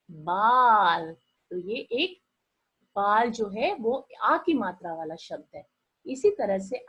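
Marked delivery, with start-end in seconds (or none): 0.1-1.1 बाल stretched long and loud; drawn-out answer. Shown as a demonstration. Teacher answering her own riddle for the children, drawn out. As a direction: hold the word बाल out for the class. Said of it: "मम्मा आपकी कंघी कहाँ करती है".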